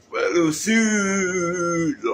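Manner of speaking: "sud" held on one long sliding note; comically elongated last word, "sud"; drawn-out emphasis. Acted. Mock insistence on southern origin.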